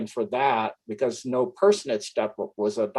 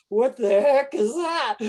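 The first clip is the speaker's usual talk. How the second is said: mock shock; 13 semitones higher than usual. Imagined onlookers seeing the creature.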